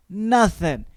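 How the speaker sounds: usual speaking voice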